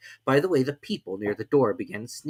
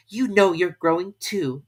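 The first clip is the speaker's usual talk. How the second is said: slow, louder retort; slow, firm girl's voice; bold Alice; 8 semitones higher, 7 dB louder than usual. A narrator's reading. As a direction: stand up to him boldly. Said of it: "said Alice more boldly".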